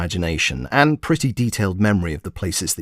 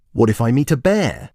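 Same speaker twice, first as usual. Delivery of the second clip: ordinary delivery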